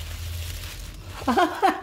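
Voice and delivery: a soft airy giggle. Real, not acted. Reading that the gift is a surprise, soft giggle. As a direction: breathe out a little laugh of surprise.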